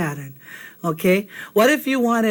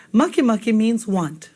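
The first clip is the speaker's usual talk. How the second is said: usual speaking voice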